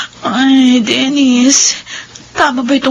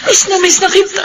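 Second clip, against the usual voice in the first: crying out through tears; tearful crying; 3 semitones higher than usual. Acted. Widow crying to her dead husband, sobbing. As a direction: sob the words to him.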